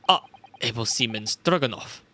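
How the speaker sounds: normal talking voice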